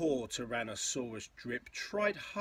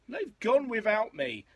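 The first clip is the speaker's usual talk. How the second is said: higher, plaintive call; mild storybook cry; 9 semitones higher, 7 dB louder than usual. A narrator's reading. Left-behind young dinosaur calls out, mild storybook cry. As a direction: cry it out, lightly acted. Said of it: "And he cried".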